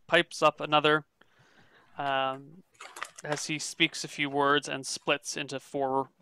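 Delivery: ordinary talk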